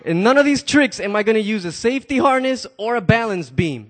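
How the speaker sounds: ordinary talk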